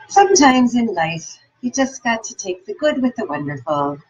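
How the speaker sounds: a light Irish accent, imitating a kindly doorman; a touch of Irish lilt, imparting wisdom with a wink. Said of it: "with his little Irish accent".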